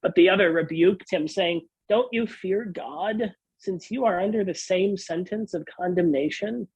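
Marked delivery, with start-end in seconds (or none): none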